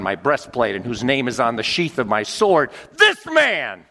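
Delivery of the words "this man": high, loud, falling on "This"; emphatic soldier. Acted. Centurion insisting on this man, loud. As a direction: slam the word "This".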